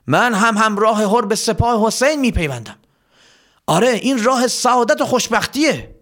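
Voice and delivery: high-pitched, eager pledge; rousing declaration. Acted. A soldier pledges to follow Hor, fervent and rousing. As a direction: declare allegiance with lifted pitch.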